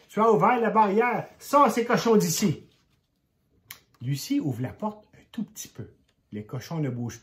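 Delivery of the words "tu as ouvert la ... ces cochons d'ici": higher, louder scolding father; angry shout. A narrator's reading. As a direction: order the pigs out, furious. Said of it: "lui crie son père". (père en colère qui crie plus fort)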